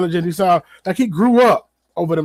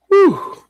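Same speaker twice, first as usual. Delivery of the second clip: sharp high amazed whew; whistle-like whew of amazement; 4 dB louder than usual. Real, not acted. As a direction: blow out a high, loud whew of disbelief. Amazed reaction to the revelations.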